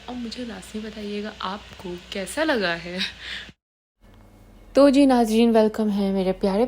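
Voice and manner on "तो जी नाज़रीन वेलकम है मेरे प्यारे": loud host greeting. Real, not acted. Welcoming viewers into her home, loud host greeting. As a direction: call out तो जी नाज़रीन, much louder.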